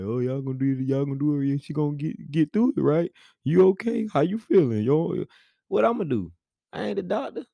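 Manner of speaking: high, frantic, hammed-up worry; mock panicking. Husband mocking a frantic version of himself, high panicky voice. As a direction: play a clueless panicked dad. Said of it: "not coming in worried about nothing".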